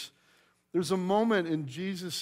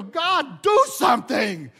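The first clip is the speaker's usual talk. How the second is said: much higher, loud shouted plea to God; desperate plea; 7 semitones higher, 11 dB louder, slower than usual. Acted. Believers praying for a hard heart, loud desperate plea. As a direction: cry out for God to act. Voicing the congregation's prayer.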